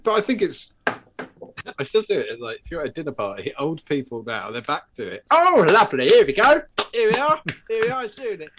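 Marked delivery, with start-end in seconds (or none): 5.3-8.5 put-on high, loud fussy old person; mocking old folks. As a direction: ham up a fussy pensioner. Mocking old people at dinner.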